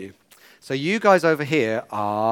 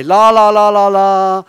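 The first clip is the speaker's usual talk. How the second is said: louder, higher la la la sung out; loud call-out demo; 4 semitones higher, 8 dB louder than usual. Shown as a demonstration. Leader shows kids how loud to call out. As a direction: belt out the la las so the kids copy. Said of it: "in the loudest voice you can".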